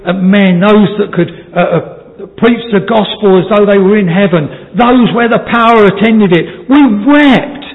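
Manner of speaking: high, loud pulpit voice; fervent preaching. Acted. Preacher tells of great men who wept, fervent preaching. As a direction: preach it forcefully, rising to the final words.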